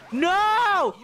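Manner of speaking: high loud shouted no; anguished shout. Real, not acted. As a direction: yell in dismay. Sudden failure.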